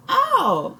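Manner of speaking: sharp high yelp of pain; little boy scratched by the cat, yelping; pained cry. A narrator's reading. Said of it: "the little boy cries".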